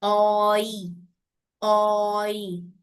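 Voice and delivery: ओई glided from ओ to ई; teacher demonstrating the ओई glide; diphthong demo. Shown as a demonstration. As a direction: model ओई with lips gliding. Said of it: "Your lips are gliding".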